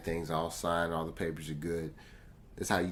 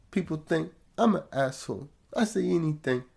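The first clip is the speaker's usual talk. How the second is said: higher, lightly voiced rapper imitation; 11 semitones higher, 4 dB louder than usual.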